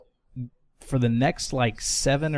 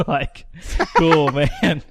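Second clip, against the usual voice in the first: laughing; 5 semitones higher, 5 dB louder, much slower than usual. Real, not acted. Laughing at feeding teammate.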